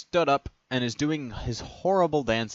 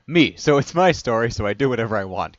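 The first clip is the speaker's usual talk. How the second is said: bossy; 9 dB louder than usual. Acted. Alex asserts control.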